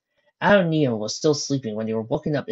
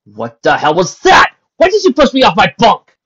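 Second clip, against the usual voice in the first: much higher, louder furious shout; 9 semitones higher, 15 dB louder than usual. Acted. Neo shouting at Lucy, furious. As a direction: yell with rage. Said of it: "shouted at her".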